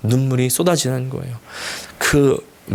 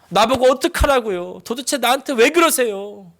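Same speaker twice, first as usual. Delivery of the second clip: anguished prayer; 8 semitones higher than usual. Retold or imitated. Pastor reenacting anguished night prayer.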